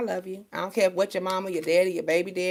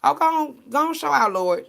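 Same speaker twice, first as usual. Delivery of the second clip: excited call; 8 semitones higher, 5 dB louder than usual. Real, not acted. Calling on the Lord.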